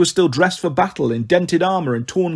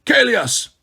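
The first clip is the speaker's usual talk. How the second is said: raised, higher-pitched call for a servant; 7 semitones higher than usual. A narrator's reading. King calling for his missing servant, calling out. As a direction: summon him from across the hall.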